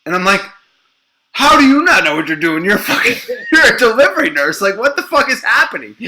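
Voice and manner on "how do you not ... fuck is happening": loud, high, incredulous outburst; exasperated disbelief. Retold or imitated. Father to clueless delivery nurse, exasperated. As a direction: retell it outraged, with a laugh breaking in.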